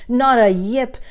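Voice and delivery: plain reading voice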